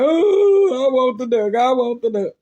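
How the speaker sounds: loud, high wail, tailing down; mock bawling. Child made to leave the duck behind, mock bawling. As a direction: wail like a heartbroken child, hammed up. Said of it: "I'm bus dying crying".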